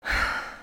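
a brief, soft weary sigh; tired sigh. A narrator's reading. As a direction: let out a small resigned sigh. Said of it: "Tiberius sighed again".

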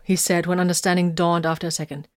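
plain reading voice

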